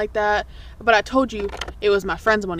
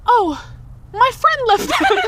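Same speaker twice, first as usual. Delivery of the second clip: put-on high, slow, innocent little-kid fib; 13 semitones higher, 5 dB louder, slower than usual. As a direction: play dumb like a little kid caught out. Child lying innocently to mom.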